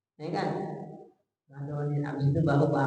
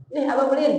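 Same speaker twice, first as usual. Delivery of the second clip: louder, stung and defensive; offended husband; 7 semitones higher, 5 dB louder than usual. Retold or imitated. Husband stung by father-in-law's jab, offended retort. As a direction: bristle as if your pride was hurt. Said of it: "Tersinggung para suaminya".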